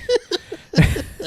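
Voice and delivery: a high-pitched giggle burst; high laugh. Real, not acted. Friend laughing at her own doctor impression, high giggle. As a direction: let a squeaky laugh out.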